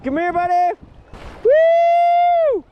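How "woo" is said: excited whoop. Real, not acted. Fish on, cheering.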